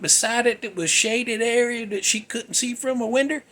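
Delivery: put-on high, eager rush of a girl who must show her mom; urgent and breathless with discovery; excited girl. Young girl pulling her mother to the backyard, excited.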